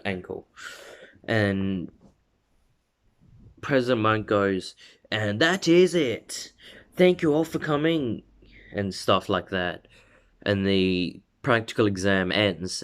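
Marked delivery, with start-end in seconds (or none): 5.1-8.2 announcer sign-off. Retold or imitated. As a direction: wrap it up like a stadium host. Announcer closes the exam.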